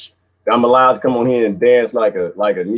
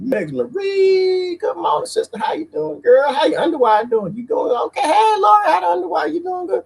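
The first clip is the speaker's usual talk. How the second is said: high calling greeting. Real, not acted. Greeting chat viewers by name with high calls. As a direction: sing out each name high and long.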